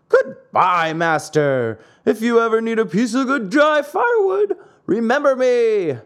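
shouted high across the water. A narrator's reading. Pinocchio shouts back to his master from afar, loud. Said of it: "called louder than before".

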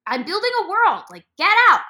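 high, loud get out; kid shooing. Retold or imitated. A kid playing pretend tells intruders, loud. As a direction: shoo them out of your room. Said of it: "And you're like".

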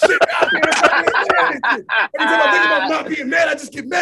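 loud, high rant through laughter; comic exasperation. Real, not acted. Man confesses he can't stop being mad, laughing exasperated shout. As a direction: shout your frustration, half laughing.

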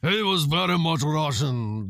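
thick mock Russian accent; hammed-up Russian wrestler accent; fake Russian accent. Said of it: "who is very much not Russian".

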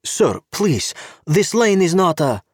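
put-on high, accented official voice; a stern Czech cop with broken English; Czech policeman. Czech cop stopping Gabe at the car, politely insistent.

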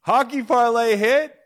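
high, loud, slow victory cry; triumphant shout. Real, not acted. Bettor announcing his hockey parlay win, triumphant shout. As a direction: belt out the win, stretched and high.

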